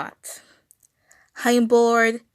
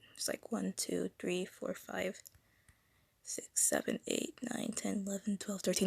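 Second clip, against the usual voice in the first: very soft, barely voiced counting; counting under the breath; 16 dB quieter than usual. Real, not acted. Streamer checking a drawing's stripes, counting under the breath. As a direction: count quietly to yourself, much softer than talk.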